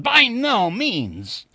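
slow, high, loud amused denial; guffawing. A narrator's reading. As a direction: boom it out as a guffaw. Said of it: "I guffawed".

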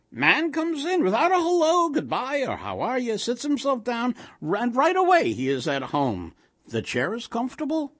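put-on high, kvetching old woman; sing-song Yiddish-inflected grumbling; indignant old wife. A narrator's reading. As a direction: complain at high pitch to the uninvited guest. Old wife complains about silent stranger.

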